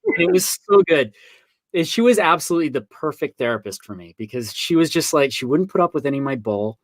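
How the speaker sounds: ordinary delivery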